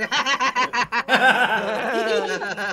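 bursting out laughing; real loud laugh; loud laughter. Real, not acted. Big laugh at joke. (tertawa lepas keras)

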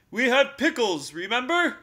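loud stern bear. A narrator's reading. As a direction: remind him firmly. Bear voiced, scolding on.

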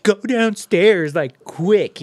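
loud, high, drawn-out panicked order; freaking out. Retold or imitated. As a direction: imitate a woman urgently sending someone downstairs. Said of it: "you could tell she was freaking out".

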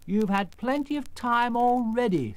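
Scarecrow. Acted. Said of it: "said the Scarecrow".

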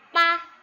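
pa loud and high, said with no tone; toneless pa. Shown as a demonstration. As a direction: sound out pa with no tone. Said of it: "Bacanya pa karena belum ada nada". (pa dibaca tanpa nada)

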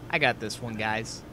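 normal talking voice

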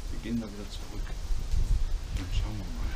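hushed, tense whisper while exploring; nervous night vlog whisper. Real, not acted. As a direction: keep it whispered, wary of noise. Quiet exploring in derelict building.

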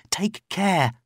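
plain reading voice

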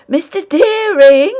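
loud and high, calling out in alarm; high panicked shout of his name; alarmed cry. A narrator's reading. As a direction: cry out to stop him. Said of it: "cried Sewell".

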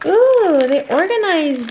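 high, loud delighted ooh. Real, not acted. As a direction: gush with delight. Delighted reaction to packaging.

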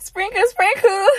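high, bright little sing-song; playful squeaky sprinkle voice. Pretend. A silly sprinkle voice said to her own viewers. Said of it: "sprinkle voice".

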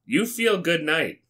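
ordinary delivery

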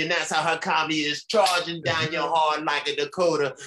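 ordinary talk

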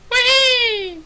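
shrill gleeful squeal; high falsetto whee. Real, not acted. Gleeful yelp in game.

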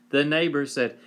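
usual speaking voice

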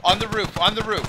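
high, loud urgent callout. Real, not acted. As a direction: yell it out to the squad. Enemy spotted, calling out.

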